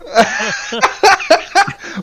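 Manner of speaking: short high laugh. Real, not acted. Player laughs at joke.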